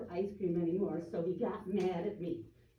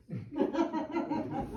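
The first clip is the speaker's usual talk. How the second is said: real amused laugh; 7 semitones higher than usual. Real, not acted. Telling of the cranky wife, laughing. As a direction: laugh at your own story.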